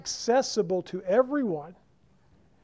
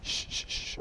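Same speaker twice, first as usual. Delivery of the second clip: put-on hissed shush to quiet a child; 12 dB quieter than usual. Parent on the phone shushing a child, unvoiced hiss. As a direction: hush the kid while on the phone.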